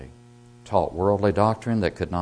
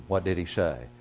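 ordinary talk

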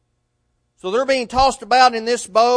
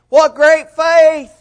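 mock admiration; 8 semitones higher, 10 dB louder, much slower than usual. Mock praise of Peter's faith.

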